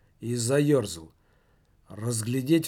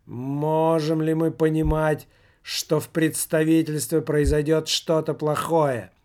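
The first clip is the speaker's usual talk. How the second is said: seance summoning; 3 semitones higher, 4 dB louder than usual. Acted. Medium summoning the spirit.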